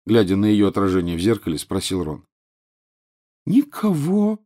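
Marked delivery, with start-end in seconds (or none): 3.5-4.4 put-on high, mopey ghost-girl voice; sad ghost girl. A narrator's reading. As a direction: sigh it like a lonely ghost. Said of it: "грустно пощипывая подбородок".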